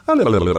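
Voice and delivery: quick high la-la-la noise; mimicking your own odd outburst; imitated babbling. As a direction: babble a string of nonsense sounds. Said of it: "He's been around me when I'm like".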